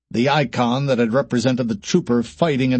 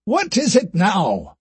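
slow, higher irritated question; annoyed; 8 semitones higher, slower than usual. Acted. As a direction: sigh out the irritation. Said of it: "more annoyed by the raised voice".

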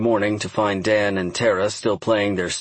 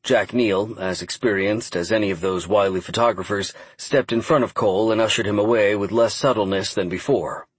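plain reading voice

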